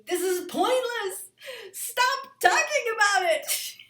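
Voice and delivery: hammed-up shout of frustration; inner scream. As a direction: blow up in mock frustration. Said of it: "inside you're screaming".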